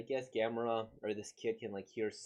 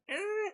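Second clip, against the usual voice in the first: high screeching imitation roar; Godzilla roar; 5 dB louder than usual. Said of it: "I could do Godzilla going".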